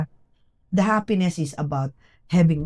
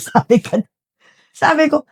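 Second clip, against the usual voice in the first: ordinary talk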